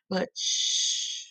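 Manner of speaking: soft breathy shh; hushing. Acted. Teacher hushes the children before the next sound, softly. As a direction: hush the children to listen.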